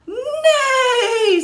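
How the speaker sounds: high, loud whinny; whinny like a startled horse; horse neigh. Acted. Horse whinnies when unmasked in the story, high and loud. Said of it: "says the horse".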